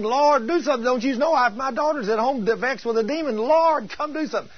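high, urgent acted plea; insistent begging. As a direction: keep pleading in a high voice, crying out on "Lord". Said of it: "she's begging".

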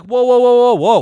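loud, high "whoa"s pulling him up short; retold alarmed stop. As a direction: hit the brakes on his plan, loud and high. Stopping friend's detour plan.

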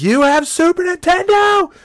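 shrieking in high, loud disbelief; ecstatic shout. Retold or imitated. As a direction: scream it like the best news of your life. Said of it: "I was so excited".

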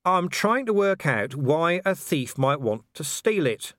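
slow, deliberately patient; strained patience. A narrator's reading. Investigator explains motive question to official, strained patience. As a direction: spell it out, holding back impatience. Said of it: "as patiently as he could".

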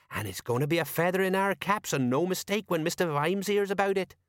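self-important sergeant, gloating; high, pleased character voice; Colon. Acted. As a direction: crow like a proud detective.

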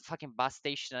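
ordinary talk

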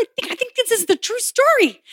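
a hint of a wide-eyed child; excited realization. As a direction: gush with childlike certainty. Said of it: "on the edge of my seat going".

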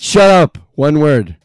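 loud. Real, not acted. Host scolding contestants loudly.

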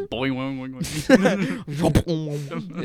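boing sound effect. Pretend. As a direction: bounce like a cartoon spring. Imitated bouncing sound.

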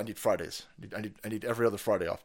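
ordinary talk, nothing marked

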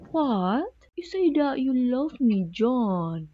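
high, swooping Welsh lilt with stretched vowels; confused Welsh accent. Shown as a demonstration. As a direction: sound baffled in an exaggerated Welsh singsong. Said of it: "you have to sound like you are, uh, confused".

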